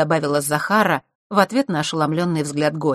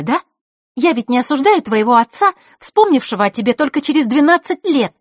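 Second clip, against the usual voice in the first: raised, high persuasive voice; persuading girl; 6 semitones higher, 5 dB louder than usual. Acted. Scene: Zakhara stopping her guest from leaving, raised. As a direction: talk her down, half teasing.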